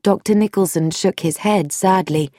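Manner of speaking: ordinary delivery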